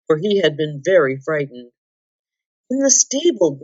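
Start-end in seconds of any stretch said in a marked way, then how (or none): 2.7-3.6 high, lightly gasped reply; a touch of suppressed giggle, pitched up; stifled-laughter gasp. A narrator's reading. Jill answers Eustace while struggling not to laugh, high light gasp. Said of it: "gasped Jill".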